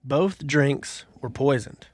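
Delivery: slow, deliberate riddle read. Acted. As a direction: read it out slowly for suspense. Reads the riddle aloud slowly.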